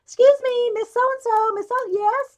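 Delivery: high, loud, eager "excuse me"; eager hand raise. Retold or imitated. Student calling out eagerly to the teacher, high and loud. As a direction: call out to the teacher from the back of the room. Raising hand in class, retold.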